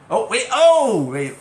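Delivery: sudden high "oh, wait"; surprised. Real, not acted. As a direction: catch yourself, startled. Startled by a quiz answer.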